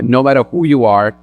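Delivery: ordinary talk, nothing marked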